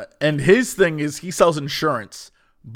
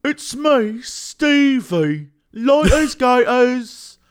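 higher, exaggerated British character voice; British accent; 7 semitones higher, much slower than usual. Fan imitating the Moon Knight dude, high British accent. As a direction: announce yourself in hammed-up British. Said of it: "like this British accent that is".

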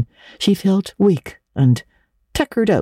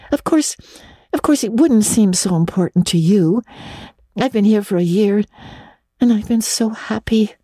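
usual speaking voice

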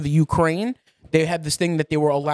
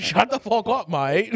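high, hammed-up Australian accent; mock Australian accent; 6 semitones higher, slower than usual. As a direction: put on a cheeky fake Australian. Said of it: "but you sound Australian".

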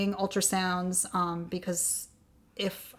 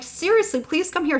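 much higher and louder, pleading urgency; insist, now really calling for him; urgent call; 10 semitones higher, 6 dB louder than usual. Retold or imitated. Third call, growing impatient.

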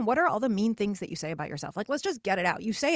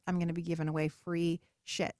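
ordinary delivery

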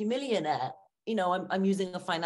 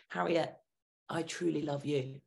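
soft, lower and hushed, meaning every word; tender, truly loving; 6 semitones lower, 7 dB quieter than usual. Shown as a demonstration. Speaking lovingly to herself in the mirror, soft and tender. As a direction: say it softly, as a real confession of love. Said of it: "that becomes".

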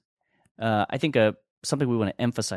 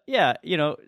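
ordinary delivery

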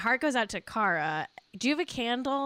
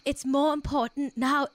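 higher, louder attempt at a British accent; badly faked British accent, pitched up; Cara's British accent; 5 semitones higher, 5 dB louder than usual. Host trying Cara's accent for a quoted post, shaky British impression. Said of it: "I'll do this in Cara's accent".

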